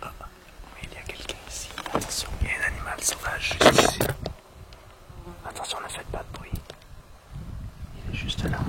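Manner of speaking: hushed whisper, barely voiced; wildlife watching whisper. Real, not acted. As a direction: whisper so you don't scare it. Spotting animal on trail.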